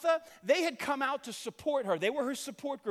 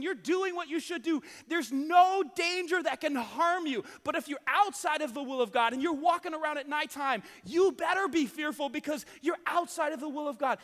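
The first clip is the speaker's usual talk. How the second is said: loud preaching, building to a shout; 5 semitones higher, 4 dB louder than usual. Real, not acted. Preacher warning the congregation about God's will, loud fervent preaching. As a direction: hammer the warning home to the congregation. Preacher driving home his point.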